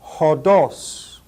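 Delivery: hodos as HO-doss with breathy h; hodos, h sound up front; hodos, Greek demo; rough breathing h. Shown as a demonstration. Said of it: "Notice the rough breathing mark".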